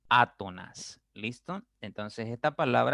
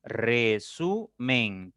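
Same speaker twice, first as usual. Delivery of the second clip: very slow "Resumen", each syllable stretched and set apart; "Resumen" broken into three long beats; much slower than usual. Shown as a demonstration. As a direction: sound out "Resumen" as Re, su, men. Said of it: "Tiene tres sílabas".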